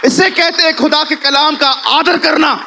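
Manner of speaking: slow, loud, high proclamation; a preacher's climactic proclamation; preaching shout. Real, not acted. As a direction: shout it out slowly to the crowd. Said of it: "climax of sermon point".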